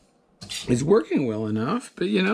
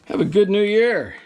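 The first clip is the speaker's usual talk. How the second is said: bright, loud higher sign-off; cheerful goodbye; 8 semitones higher, 5 dB louder than usual. Real, not acted. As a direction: wish them well with a lift.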